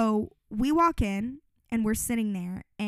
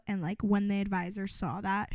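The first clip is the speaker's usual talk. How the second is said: normal talking voice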